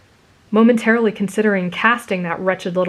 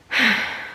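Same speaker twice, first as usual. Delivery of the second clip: long breathy exhale; mournful sigh; 3 semitones higher than usual. A narrator's reading. Girl alone in the bathroom, mournful sigh. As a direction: sigh out the heartache. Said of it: "muffling your mournful sigh".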